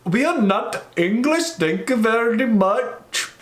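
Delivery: put-on slow, high Swedish accent. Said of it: "that's my Swedish accent".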